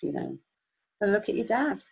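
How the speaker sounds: usual speaking voice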